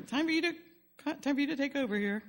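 comic retold call for rescue; high, strained cheer. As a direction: say it high and sweet, handing the child over. Comic call for help to mom.

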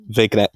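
"Vignette" said as vig-net, hard g; deliberate mispronunciation. Pretend. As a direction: sound the g in "Vignette" on purpose. Joking on own mispronunciation.

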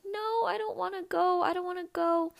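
put-on high, tearful child's wail; mock the little girl's tearful protest; crying child. Girl refusing to leave her room, imitating high tearful protest. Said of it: "I was, like, crying".

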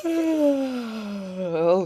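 talking through a yawn. Real, not acted. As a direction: stretch it out on a yawn. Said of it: "sorry, I'm yawning".

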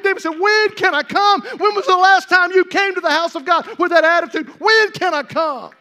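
high, building to full-voiced shouts; fervent preaching. Real, not acted. Preacher crying out David's longing for God's house, fervent preaching. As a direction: preach with rising force, stretching the big words.

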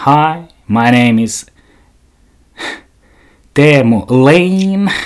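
slow, drawn-out English self-introduction; imitating English introduction. Imitating the podcaster introducing herself in English, slow. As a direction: stretch it out like a nervous podcaster. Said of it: "hän esittelee itsensä aluksi tälleen".

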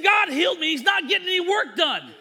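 loud, high complaint; comic indignant outburst; mock exasperation. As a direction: play a fed-up friend grumbling at full volume.

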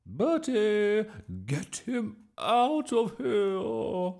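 very high, loud, drawn-out order; squawk. A narrator's reading. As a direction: squawk in panic at the boy. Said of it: "squawked Miss Boot".

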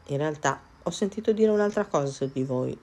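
usual speaking voice

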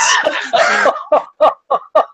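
high laugh falling away; burst of laughter. Real, not acted. Podcaster laughing as the monster kills Sudeikis. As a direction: cracks up at the twist.

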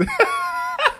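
very high squeal of laughter. Real, not acted. Forgot lyrics mid-song.

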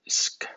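a bare, voiceless "sk"; SCH as sk. Shown as a demonstration. Teacher isolates the SCH sound, voiceless "sk". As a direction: say "sk" as two consonants without any vowel. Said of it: "S C H suena".

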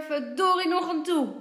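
father's loud, high curse; angry shout. A narrator's reading. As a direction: call out in exasperation from another room. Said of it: "Hij roept weer".

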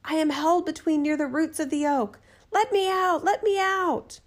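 high, pleading voice of a captive; trapped voice calling. A narrator's reading. Captive answers from among oak roots, high calling plea. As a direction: call up from under the roots, high and plaintive. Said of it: "The voice answered".

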